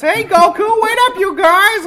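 Terry Clausen's Krillin. Retold or imitated. Actor mimics his predecessor's Krillin voice, high and loud. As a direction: call out in a high, loud sidekick voice. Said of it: "his voice was like this".

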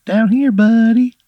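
squeaky high cartoon pickle calling out; little pickle shouting up from the plate; tiny pickle voice. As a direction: call up from below in a tiny squeaky voice. Talking pickle calls to player.

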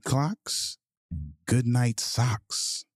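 usual speaking voice

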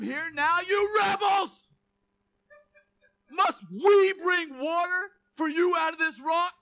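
furious shouted rebuke, slow and high; drawn-out angry shout at the crowd. Acted. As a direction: bellow at the rebels like an enraged prophet. Moses rebuking the rebels.

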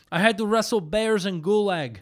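put-on Russian accent. Shown as a demonstration. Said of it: "When you do Russian accent".

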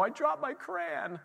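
put-on high, slow small-child whimper; a hint of a small child; little kid voice. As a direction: imitate the toddler, slow and high. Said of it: "it's just like".

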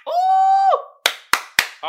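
high whooping hype cry. Real, not acted. Host whooping to hype the memes. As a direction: whoop it up like a crowd. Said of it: "let's get the hype".

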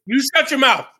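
high, loud comic retort; mock outrage. Gamer told it's not impossible, mock-outraged retort. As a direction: snap back in playful fury.

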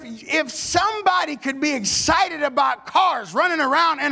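rising, punchy church exhortation; rally the congregation to worship; fired-up preaching. Acted. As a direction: pastor preaching at full force.

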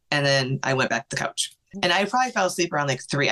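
ordinary talk

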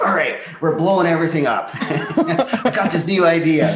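playful imitation of an overexcited boss; hyped-up team leader. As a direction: burst in with a big idea, laughing. Team leader back from conference, acted.

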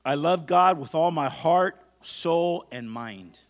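slow, loud preacher's affirmation; emphatic, stretched-out call for repetition; leading a declaration. Acted. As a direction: proclaim it phrase by phrase for the congregation to echo. Leads a congregation in declarations.

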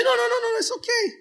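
squeaky high mock of a dismissive friend. Preacher mocking a friend brushing off an apology, in falsetto. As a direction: toss off the reassurance in a silly falsetto.

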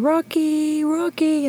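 high calling out for the dog. Retold or imitated. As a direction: call the puppy's name across the yards. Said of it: "So we're screaming".

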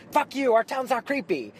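high, loud, indignant retort; angry locals. Retold or imitated. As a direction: snap back, offended. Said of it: "they were like".

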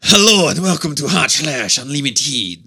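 Pepe-style cartoon French; French accent. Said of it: "That's Pepe".

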